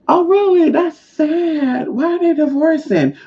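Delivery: high put-on gossip voice; fake sympathy. Reacting to news of the neighbours' divorce, fake sympathy. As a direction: feign shocked pity way up high. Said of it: "you gotta get in that frequency".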